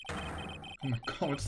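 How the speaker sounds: hushed, slow whisper. Real, not acted. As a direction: breathe it out in a whisper. A shocked whisper.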